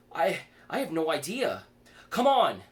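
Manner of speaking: scared, rushing him along; frightened. Acted. Kaylee after hearing a scream, frightened and urgent.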